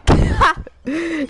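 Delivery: loud, high burst of laughter. Real, not acted. Streamer laughing at a friend's voice, loud high laugh. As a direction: crack up suddenly, high and loud.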